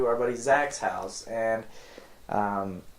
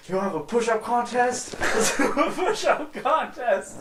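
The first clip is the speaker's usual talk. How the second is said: put-on high, laughing drunk bravado; hyped drunk challenge; 10 semitones higher than usual. Drunk teen challenges his friend, high laughing hype. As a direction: dare him to a contest, all pumped up.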